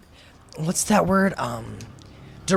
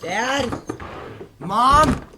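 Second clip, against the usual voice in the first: high, raised call through the house; 7 semitones higher than usual. Acted. Boy calls for his mother in the empty house, raised. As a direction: call out, searching for her.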